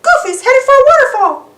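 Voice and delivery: high, loud cartoon mouse warning; alarmed Mickey. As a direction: shout the danger in a squeaky voice. Mickey sees Goofy in danger.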